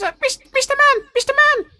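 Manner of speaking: very high, quick pleading goblin. Acted. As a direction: squeak it out like a worried little goblin. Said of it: "He goes".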